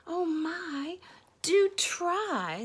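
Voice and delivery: high little storybook voice; pleading fly. Acted. Fly begging the elephant to hold on, high pleading.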